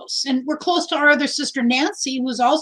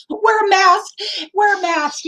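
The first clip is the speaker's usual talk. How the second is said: higher-pitched, through a laugh; laughing reminder; 7 semitones higher than usual. Real, not acted. Vlogger reminding a fellow hugger about masks, laughing. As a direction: laugh as you urge it, pitched up.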